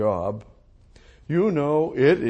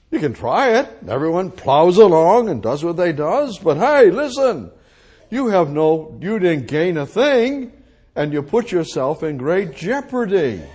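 louder, raised preaching to the congregation; forceful preaching; 5 semitones higher, 6 dB louder than usual. Acted. Preacher warning the congregation, forceful pulpit delivery. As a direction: press the warning home from the pulpit.

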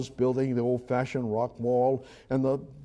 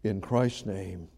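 closing prayer; 2 semitones lower than usual. Acted. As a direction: pray to close the sermon.

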